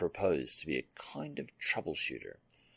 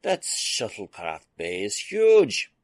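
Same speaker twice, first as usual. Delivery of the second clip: slow, much louder awestruck marvel; an engineer gushing over the ship's huge bay; childlike wonder; 5 semitones higher, 11 dB louder, slower than usual. Acted. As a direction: savor it like a kid with a new toy. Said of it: "He spoke as a child perusing the latest toy".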